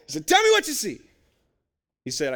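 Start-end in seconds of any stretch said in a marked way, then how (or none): 0.3-1.0 loud, with an attempt at a Jamaican accent; a commanding order in a bad Jamaican accent. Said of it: "my Jamaican accent's terrible".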